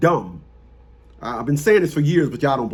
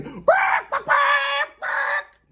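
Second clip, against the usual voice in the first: 1 high shout; much slower than usual. Real, not acted. Shouting at a chicken/bird.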